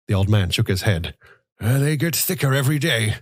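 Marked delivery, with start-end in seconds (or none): 1.6-3.2 put-on first try, raised toward a thin old voice; a milder complaint, pitched higher; old man complaining. A narrator's reading. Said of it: "he complained in a thin, undependable falsetto".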